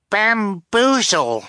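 high, drawn-out little cry; child's cry. A narrator's reading. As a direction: call it out slowly, way up high. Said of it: "Sonny cried".

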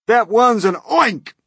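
high, loud, drawn-out scream; screamed alarm. A narrator's reading. Fire chief seeing the grasshopper, screamed alarm. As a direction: scream out what the monster is. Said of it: "screamed the head of the fire department".

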